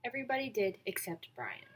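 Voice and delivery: plain reading voice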